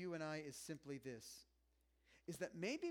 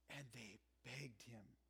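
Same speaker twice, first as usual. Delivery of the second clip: hushed emphasis; 6 semitones lower, 10 dB quieter, slower than usual. Real, not acted.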